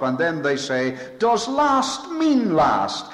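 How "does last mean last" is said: slow, much higher sneering challenge; mock the doubters' taunt; cynical question. Preacher mimicking doubters' cynical taunt, high and slow. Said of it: "they ask that very, very cynically".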